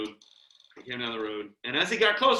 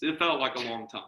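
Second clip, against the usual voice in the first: ordinary talk, nothing marked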